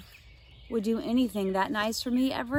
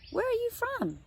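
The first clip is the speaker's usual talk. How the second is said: put-on much higher, girlish question; curious question. Josie asks Vanessa where she is from in a high voice. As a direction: ask it brightly, up high.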